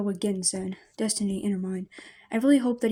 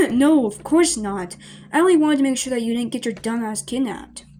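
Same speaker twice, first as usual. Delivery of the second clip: flustered scoff; 3 semitones higher, 8 dB louder than usual. Acted. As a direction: bluster it out loudly to hide embarrassment. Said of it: "He scoffs and crosses his arms".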